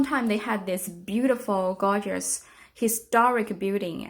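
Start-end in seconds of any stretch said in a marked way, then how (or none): none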